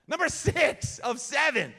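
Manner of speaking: loud, high crowd-pumping yell; hype shout. Real, not acted. Speaker pumping up students, loud hype shout. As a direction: fire up the crowd. Hyping a school chapel crowd.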